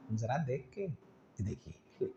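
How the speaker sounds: जरा देख के said tenderly with a smile; soft smiling reminder. Shown as a demonstration. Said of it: "मुस्कुरा कर आप". (मुस्कुराहट के साथ नरमी से)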